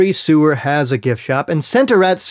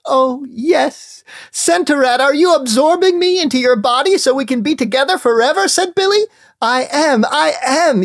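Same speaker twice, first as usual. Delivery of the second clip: ecstatic; 9 semitones higher than usual. Acted. Billy and rat merge.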